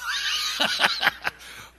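big burst of laughter; loud laugh. Real, not acted. Laughing at the punchline.

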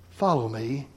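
soft, tender, earnest invitation; gentle but firm call. Shown as a demonstration. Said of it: "with a sense of both earnestness and tenderness".

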